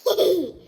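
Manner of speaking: a short giggle at being tickled; ticklish giggle. Acted. Larry tickled.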